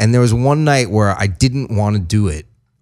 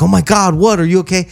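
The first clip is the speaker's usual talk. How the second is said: high, alarmed hippie voice; a peace-and-love guy suddenly scared; lightly voiced hippie, pitched up in alarm; worried hippie; 8 semitones higher, faster than usual. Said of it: "He's like".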